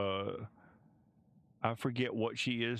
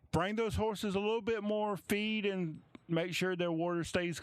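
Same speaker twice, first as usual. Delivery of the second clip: higher-voiced stable hand barking orders; stable hand ordering; 8 semitones higher than usual. Stable hand ordering the other boys about feed, higher voice. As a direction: give the stable boys their orders, pitched up.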